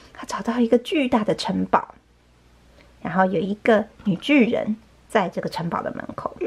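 usual speaking voice

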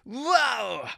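lightly voiced baby zombie going wow; a hint of a tiny zombie's amazement. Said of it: "Baby zombie says".

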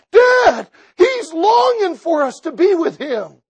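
high, loud, tearful pleading; crying excited plea. Acted. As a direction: beg your father through tears. Levi pleads with father, crying.

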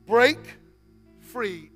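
normal talking voice

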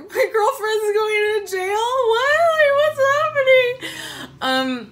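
high, slow, tearful and loud; a frantic crying wail, pitched very high; crying panic. Retold or imitated. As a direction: sob it out in drunken panic. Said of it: "I was just crying and freaking out".

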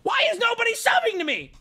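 hammed-up high-pitched griping; mock entitled streamer. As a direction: wail it like an aggrieved streamer. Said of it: "people who are on here going".

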